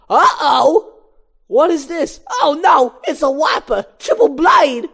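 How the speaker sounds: high, loud, alarmed shouting; Chris Rock. Acted. Moose Blood spots the wiper, panicked comedian shout. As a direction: panic like a shrieking stand-up comic. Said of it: "I'm gonna do the Chris Rock voice".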